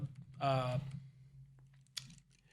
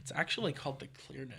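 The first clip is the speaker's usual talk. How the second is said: quiet smug correction; a know-it-all setting it straight; muttered nerdy correction; 3 semitones lower, 10 dB quieter, faster than usual. Retold or imitated. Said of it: "You can be like".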